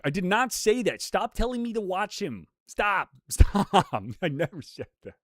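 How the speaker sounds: high, exasperated pleading that breaks into laughter; mock protesting. Real, not acted. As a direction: protest hard, then crack up. Mock protest at chat's claim.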